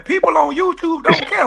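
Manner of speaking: usual speaking voice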